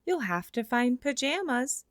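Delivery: ordinary delivery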